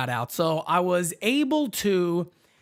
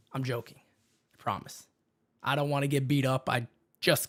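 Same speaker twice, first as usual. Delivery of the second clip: much softer, lower backpedal; sheepish quiet; 9 semitones lower, 7 dB quieter than usual. Real, not acted. Host backpedaling after the [name] joke, quiet and sheepish. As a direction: take it back under your breath.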